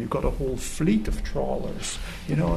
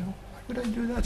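higher, softer lazy fisherman voice; fisherman shrugging off advice; 10 semitones higher, 9 dB quieter, much faster than usual. Retold or imitated. Fisherman answering the German tourist, higher and softer, unbothered. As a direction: toss it off like a man in no hurry.

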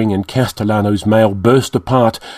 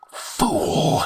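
a breathy, voiceless hiss; 6 semitones higher, 8 dB quieter than usual. A narrator's reading. Villain hissing at his foe in the circle. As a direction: hiss the word at him. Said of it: "he hissed".

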